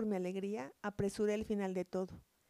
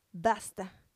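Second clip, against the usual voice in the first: hoarse cry; 2 semitones lower, 3 dB louder than usual. A narrator's reading. First lover confronting the narrator on the path, restrained hoarse cry. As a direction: narrate a short, husky cry of "enough". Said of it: "gritó con voz ronca".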